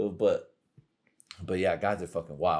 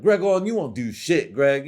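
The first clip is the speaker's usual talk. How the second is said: high, loud goading voice; raised, mocking challenge; taunting dare; 6 semitones higher, 6 dB louder than usual. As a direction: goad him like a pushy cousin. Acting out cousins' taunting dare.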